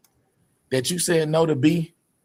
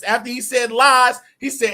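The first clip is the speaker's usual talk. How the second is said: ordinary delivery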